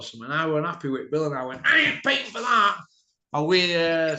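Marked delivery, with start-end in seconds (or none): 1.6-2.8 raised voice, flat refusal; defiant refusal. Retold or imitated. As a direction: refuse loudly and firmly. Retold refusal.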